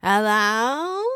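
a curious bird asking again; questioning parrot. A narrator's reading. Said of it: "Mango repeated".

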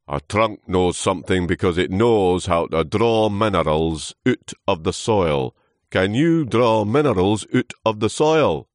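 loud, scornful character voice; lecturing old trunk. Acted. Old trunk testing Lewis, loud lecturing. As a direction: quiz him loudly and pompously.

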